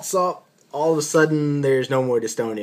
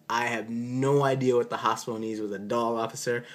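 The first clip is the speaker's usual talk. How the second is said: usual speaking voice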